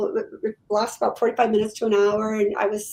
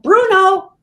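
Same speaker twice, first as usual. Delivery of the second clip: sudden loud call with a higher pitch; yelled name; 8 semitones higher, 10 dB louder than usual. Retold or imitated. Dog speaking through the medium yells its own name loudly. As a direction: blurt the dog's name out, loud and high. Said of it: "I yelled out".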